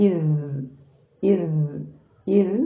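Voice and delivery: "ഇസ്" with the final voiced consonant held at its articulation point; lingering dhal. Shown as a demonstration. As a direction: spend time on the last sound of "ഇസ്". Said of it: "മഹ്റജിൽ time spend ചെയ്യുന്ന".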